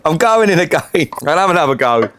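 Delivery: ordinary delivery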